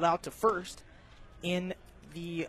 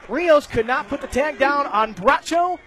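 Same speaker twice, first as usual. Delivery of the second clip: energetic recap; 6 semitones higher, 6 dB louder than usual. Real, not acted. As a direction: still keyed up, louder, recapping the runs. Recapping the scoring play.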